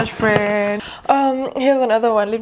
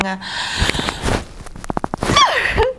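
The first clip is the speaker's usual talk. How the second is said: high breathy laugh. Real, not acted. Laughs over the blanket.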